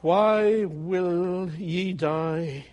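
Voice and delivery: drawn-out, grieving plea; slow pleading. Acted. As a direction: plead slowly with the sinner. Pleading scripture quote after pause.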